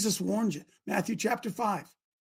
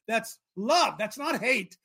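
ordinary delivery

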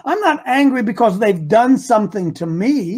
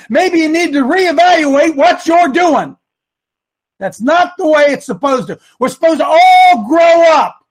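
loud preaching, rising to a shout; impassioned exhortation; 6 semitones higher, 7 dB louder than usual. Real, not acted. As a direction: drive it home louder and louder.

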